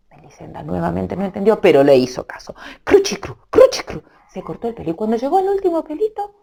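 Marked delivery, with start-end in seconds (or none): 2.8-4.0 high crisp snipping noise; scissor snip sound. Acted. Storyteller voicing the scissors cutting Melisenda's hair: high snips.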